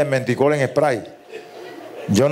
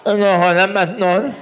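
cleft-lip voice; 4 semitones higher than usual. Acted. El ñato habla.

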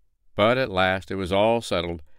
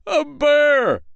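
high, loud panicked yelp; the terror of seeing a beast; frightened cry; 7 dB louder than usual. Acted. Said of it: "cried the tailor, casting one frightened glance".

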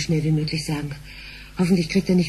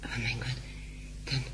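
hushed, shocked whisper; a stunned whisper; 7 semitones lower, 14 dB quieter than usual. Acted. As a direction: breathe it out in alarm. Shocked secret talk.

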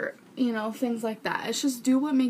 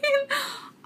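embarrassed laugh. Real, not acted. Embarrassed by dog snoring.

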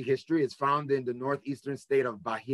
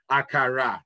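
Nigerian accent; 3 dB louder than usual. Shown as a demonstration. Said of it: "that's the way you say it properly".

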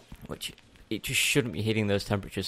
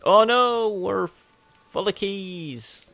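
mock alarm; 10 semitones higher, much slower than usual. Mock alarm at full inventory.

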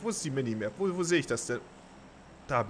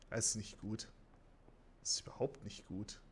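whispered worry; 5 semitones lower, 13 dB quieter than usual. Real, not acted. Tense moment, enemies nearby.

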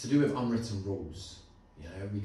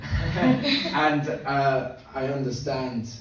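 laughing; 5 semitones higher, 10 dB louder, much slower than usual. Real, not acted. Laughs at end.

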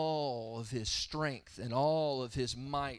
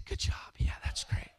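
whispered cheer. Retold or imitated. Imitates dad cheering the choking.

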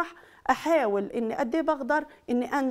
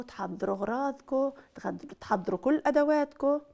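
normal talking voice

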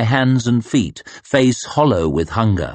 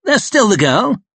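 sullen snap; 11 semitones higher than usual. Acted. Harker snapping back at the Superior, high and sulky. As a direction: snap back like a scolded child. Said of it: "he snapped".

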